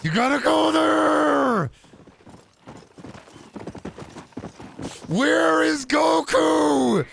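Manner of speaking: high, slow, yelling gamer voice; a frantic streamer yelling high-pitched callouts; Connor O'Malley impression. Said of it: "Connor O'Malley doing a Fortnite Let's Play".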